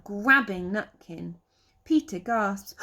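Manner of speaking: ordinary delivery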